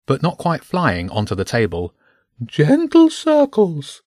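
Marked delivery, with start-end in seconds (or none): 2.4-4.0 high, slow, loud bird voice; scolding bishop bird. A narrator's reading. Bishop bird instructs James at the inkstone, scolding. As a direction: draw out the scolding in a high pigeon voice. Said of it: "the bishop scolded".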